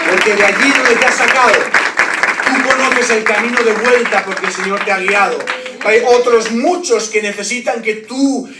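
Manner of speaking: loud, driving preacher's call; rally the congregation with full force; predicación a pleno pulmón. Acted. Predica con fuerza. (exhortación de predicador en voz alta)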